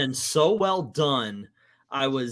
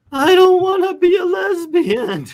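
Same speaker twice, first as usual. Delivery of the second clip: high, loud imitated wail; hammed-up bawling, pitched way up; mock crying; 9 dB louder than usual. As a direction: imitate someone wailing their eyes out. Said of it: "she is crying her eyes out".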